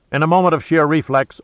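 ordinary delivery

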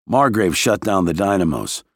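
plain reading voice

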